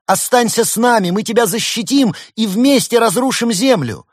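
high, loud, fervent appeal; crying out. A narrator's reading. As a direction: shout to win them over. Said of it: "выкрикнул Великий Хнун".

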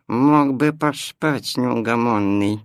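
plain reading voice

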